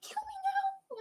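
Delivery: high, squeaky mock despair. On the drop behind a cheering kid, high mock despair. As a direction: wail it like a doomed rider. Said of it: "I was like".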